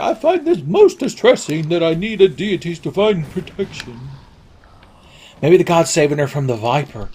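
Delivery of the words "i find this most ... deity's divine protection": the Queen. Retold or imitated. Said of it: "The Queen is like".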